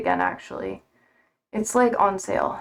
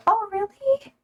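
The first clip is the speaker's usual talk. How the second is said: high, delighted squeal; real delight at a gift; excited; 10 semitones higher, 3 dB louder than usual. Real, not acted. Streamer hearing a friend sends gifts, high delighted.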